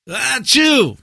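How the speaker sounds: loud high fake sneeze. Shown as a demonstration. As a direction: belt out a staged sneeze. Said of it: "all right, ready? When to sneeze".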